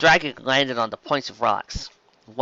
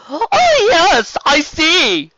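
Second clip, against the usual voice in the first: loud, high, slow and grand; flamboyant sea serpent; 10 dB louder, much slower than usual. Acted. Serpent duke welcoming the dragon, effusive and loud. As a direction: proclaim with drawn-out, high-pitched flourish.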